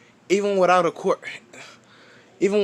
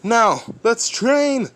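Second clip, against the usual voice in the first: a slow, higher mentor call to train; All Might; 6 semitones higher, much slower than usual. Retold or imitated. All Might telling Deku to start training, slow. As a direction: announce training like a hero mentor. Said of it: "he's like".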